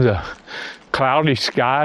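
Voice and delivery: usual speaking voice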